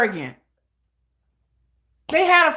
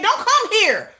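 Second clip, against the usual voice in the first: higher, quick flat brush-off; blunt dismissal; 7 semitones higher than usual. Retold or imitated. Telling fans abroad to stay away, brushing them off. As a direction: toss it off fast. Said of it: "I'm like".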